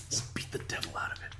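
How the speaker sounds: soft unvoiced whisper; whispered Bob Ross line. Quoting Bob Ross, in a soft whisper. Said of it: "That's what he says".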